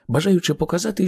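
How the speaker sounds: ordinary delivery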